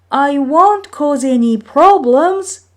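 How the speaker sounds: put-on high, loud young Maui promising; eager promise. Maui promises his brothers, high and eager. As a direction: swear you'll behave. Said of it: "promised Maui".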